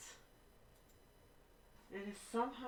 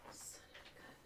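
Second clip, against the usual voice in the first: muttered under the breath; real whispered exasperation; whispered curse; 15 dB quieter than usual. Real, not acted. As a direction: hiss the mild curse to yourself. Muttered curse at frozen computer.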